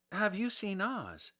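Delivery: put-on a small girl asking hopefully; Dorothy asking. A narrator's reading. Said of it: "Dorothy asked".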